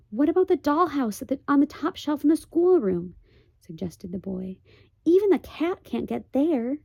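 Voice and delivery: put-on high, eager young boy; boy's voice. The boy pitches the dollhouse to Pod, high and eager. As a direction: pitch the idea hopefully.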